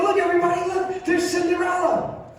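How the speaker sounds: excited tourist. Retold or imitated. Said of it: "And I go".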